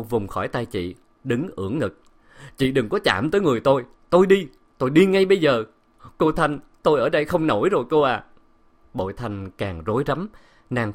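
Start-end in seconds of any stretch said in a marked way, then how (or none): 2.6-8.2 loud, fast, high protest; upset servant's hurried farewell; defiant outburst. A narrator's reading. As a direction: burst out indignantly, refusing to stay. Servant breaks free, defiant and distraught.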